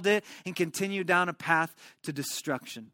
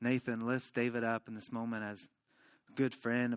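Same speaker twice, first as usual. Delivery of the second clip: usual speaking voice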